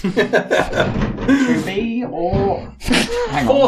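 drawn-out theatrical Shakespeare; hammy stage actor. Acted. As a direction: declaim it like a ham actor. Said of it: "Shakespearean level dramatic characters".

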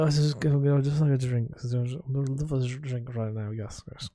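put-on slurred, rambling drunk millionaire; mumbling rich drunk, dropping away at the end; Cedric Hardcastle. Shown as a demonstration. Drunk millionaire wanting a drink, slurred rambling. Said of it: "he is a drunk millionaire".

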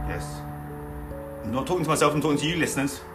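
usual speaking voice

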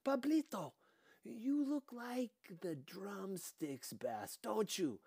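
put-on high, loud gushing older woman; a bossy mother loudly welcoming the cop; loud motherly chatter. Dika ordering everyone.

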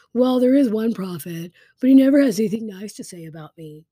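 loud, whiny pout, rushing at the end; pouty king. Acted. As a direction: sulk it out loudly like a pouty king. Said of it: "He's such a pouty guy".

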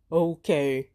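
giant voice. Acted. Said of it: "Erk finally said".

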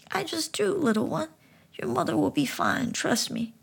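talking frog gently explaining; high frog voice. Acted. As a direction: speak like a wise little frog. Frog reassures boy.

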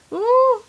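very high-pitched "woo"; high whoop. Real, not acted. Whooping at how wrong they were.

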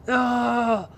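high cry building to a loud yell; imitated goal reaction. Imitating a TikTok guy watching a goal, cry building to yell. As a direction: go from dismay to an exploding mock celebration. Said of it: "Como el del TikTok, cuando va a meter un gol".

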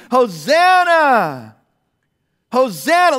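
loud, drawn-out crowd cry of Hosanna; shouted hosanna. Retold or imitated. Pastor voicing the Palm Sunday crowd, loud joyful shout. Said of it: "screaming and shouting and saying".

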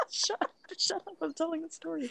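breaking up with laughter, pitch jumping high; giggling shut up while trying to keep the story going; laughing protest. Real, not acted. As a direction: laugh your way through telling them to hush. Laughing, telling off the listener.

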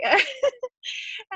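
high, flustered burst of laughter; embarrassed giggle, lost for words; high nervous laugh. Real, not acted. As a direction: laugh off the nerves.

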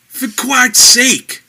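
high, slow, full-throated shout. A narrator's reading. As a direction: bellow it straight into his ear. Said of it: "shouted Bog into Laser's ear".

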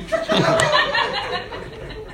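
real laugh at the punchline; hearty laugh. Real, not acted. Preacher laughing at his own joke. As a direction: laugh freely.

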